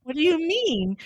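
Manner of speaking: high, loud affronted question; hammed-up offended disbelief; mock indignation. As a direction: react as if stung by your child's verdict. Mother retelling reaction to child's criticism.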